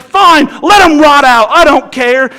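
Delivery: mock exasperation at the daughter; exasperated giving up. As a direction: throw up your hands, done with it.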